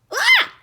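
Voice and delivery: high, loud cry of sudden pain; retold sudden yelp of pain; sharp yelp of pain. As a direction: yelp as if something jabbed you. Doctor presses a painful spot.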